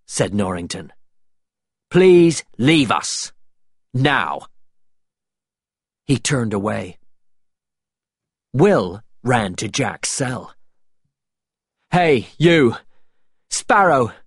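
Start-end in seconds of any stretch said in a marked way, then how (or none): 1.9-4.5 slow, emphatic order to go; raised, deliberate command; firm dismissal. A narrator's reading. Norrington dismisses Will firmly. 11.9-14.1 high, loud hail; urgent shout to a prisoner; calling out. A narrator's reading. Will calls to prisoner Jack.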